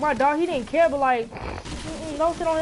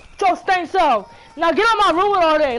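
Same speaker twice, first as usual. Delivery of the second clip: loud, high scolding; angry yelling; 3 semitones higher, 5 dB louder than usual. Real, not acted. Kid yelling at brother to get off the dog, loud. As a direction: shout him off the dog.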